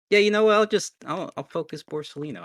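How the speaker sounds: pumped-up rising energy; excited. Real, not acted. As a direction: hype yourself up. Player hyped, deciding to go big.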